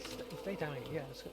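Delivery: ordinary delivery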